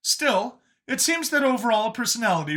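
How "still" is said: usual speaking voice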